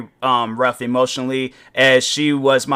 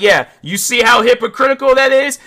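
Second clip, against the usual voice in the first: gloating retort; 9 semitones higher, 4 dB louder than usual. Retold or imitated. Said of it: "I'm like".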